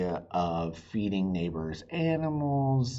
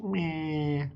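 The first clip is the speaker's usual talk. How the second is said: indifferent meh; 7 semitones higher than usual. Pretend. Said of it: "she was just like".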